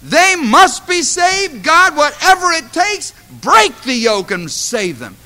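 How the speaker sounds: loud, high, pleading prayer, slowed on must be saved; fervent prayer. Shown as a demonstration. Pastor modelling a prayer for the church, loud desperate plea. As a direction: pray it with desperate force. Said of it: "go before God and say".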